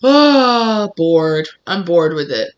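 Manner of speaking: big groan sinking into boredom; bored, underwhelmed. Acted. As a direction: act out jaded boredom, groan first.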